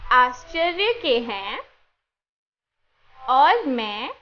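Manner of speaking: slow verse with long pauses between phrases; slow recitation for echoing. Shown as a demonstration. Teacher reciting a Bible verse for children to echo, slowly. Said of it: "मैं बोलूँगी और मेरे पीछे दोहराना".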